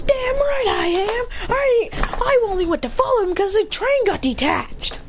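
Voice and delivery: high, drawn-out indignant self-defence; defiant protest. Acted. Child defends himself in quarrel.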